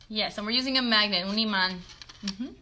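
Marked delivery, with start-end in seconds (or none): none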